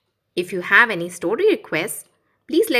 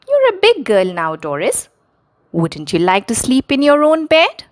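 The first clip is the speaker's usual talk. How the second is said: higher, a bit louder coaxing mother; gentle mummy voice; 6 semitones higher, 4 dB louder than usual. Acted. Mother coaxing young daughter, higher coaxing voice. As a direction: coax a little girl like a mum.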